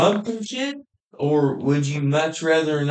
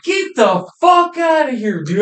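high, loud retold yell of excitement; excited yell; 8 semitones higher, 4 dB louder than usual. Friend retelling seeing the rollover video, excited yell. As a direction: shout it out, thrilled, reliving it.

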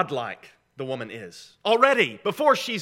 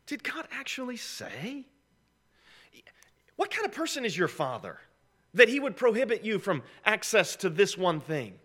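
sly serpent's questioning, rising in force; insinuating suspicion; 6 semitones higher than usual. Acted. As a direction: plant doubt, opening in a high hushed voice. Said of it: "between suspicion about God's character".

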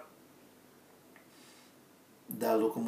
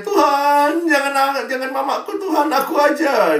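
high, loud hammed-up wail; the revived child begging God to take him instead, loud high wail; child's wailing plea; 14 semitones higher, 11 dB louder than usual. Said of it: "anaknya lihat mamanya".

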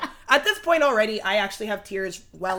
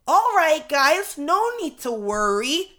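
high, loud, slow call to a crowd; mock reassuring announcement; 6 semitones higher, 5 dB louder, slower than usual. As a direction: announce it with forced cheer to everyone. Retold announcement to the angry crowd.

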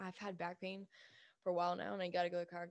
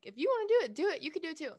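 loud, high pep talk; fired-up; 9 semitones higher, 10 dB louder, faster than usual. Real, not acted. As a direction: hype them up to do it. Fired-up encouragement.

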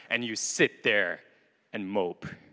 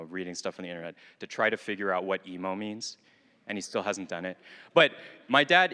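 ordinary delivery

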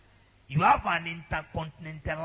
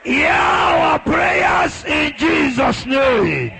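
high, loud, urgent prayer shouts; full-force prayer preaching; 8 semitones higher, 7 dB louder, slower than usual. Real, not acted. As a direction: drive the congregation into fervent prayer at full voice.

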